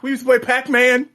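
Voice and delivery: high, loud mocking celebrity voice. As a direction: ham up a simple celebrity line.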